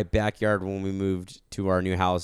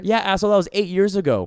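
annoyed retort; 10 semitones higher, 5 dB louder than usual. Pretend. Retorting to imagined bouncer.

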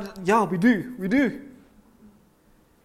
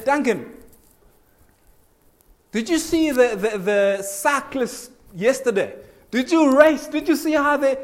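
higher, lively questions tumbling out; eager chat; 6 semitones higher than usual. Retold or imitated. Preacher to Duncan about the cycling race, eagerly. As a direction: pull him in with excitement. Lights up a dull congregant.

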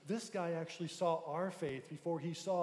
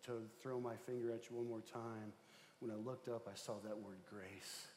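quiet retold confession; 6 semitones lower, 9 dB quieter than usual. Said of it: "the guy says".

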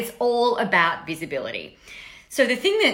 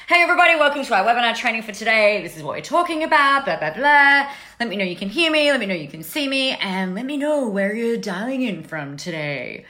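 brisk, lifted webinar welcome patter; imitating own upbeat webinar opening; webinar host; 3 semitones higher than usual. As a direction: rattle off a practised host's intro. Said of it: "I always used to say that on webinars".

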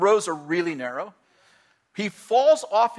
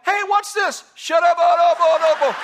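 shouting; 11 semitones higher, 7 dB louder than usual. Acted. Mock shouted outburst at congregation.

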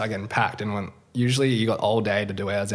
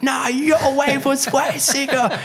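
much higher and louder, slow, laughing shout; laughing hype; 5 dB louder, much slower than usual. Retold or imitated. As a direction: shout it back through a laugh, stoked. Other surfer returns the praise.

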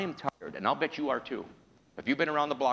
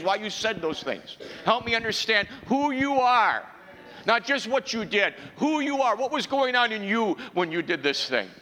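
high, loud, stretched preacher's cry; preaching at full force; 9 semitones higher, 5 dB louder than usual. Acted. Preacher pleading to hear Jesus' heart, soaring preach.

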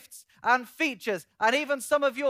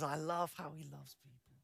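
very soft, low, acted fondness; almost murmur it, affectionately; tender admiration; 6 semitones lower, 15 dB quieter than usual. The Son admiring how he loves people, tenderly hushed.

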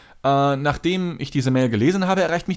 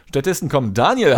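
ordinary talk

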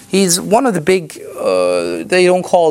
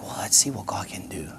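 whispered, much softer; hushed confident aside; 8 semitones lower, 12 dB quieter than usual. Retold or imitated. Preacher recalling his own response to hopeless locals, whispered. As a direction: breathe it as a quiet dare of faith. Quiet faith-filled aside.

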